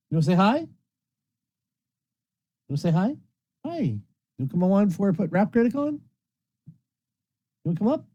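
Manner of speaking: high, sing-up questions to a pet; coaxing a pet. Real, not acted. Host invites the pet on stream, coaxing. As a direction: coax a pet with rising pitch.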